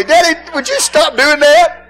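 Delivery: put-on high pleading kids' voice; mock child plea. Embarrassed children begging their daddy to stop, high and pleading. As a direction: beg like an embarrassed kid. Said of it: "They tell me".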